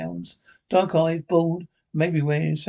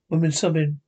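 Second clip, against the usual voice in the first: ordinary delivery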